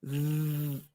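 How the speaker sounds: long soft "Z" with tongue between the teeth; voiced TH held as a buzz; interdental buzz. Shown as a demonstration. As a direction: hum the th of "the". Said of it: "Esta es interdental".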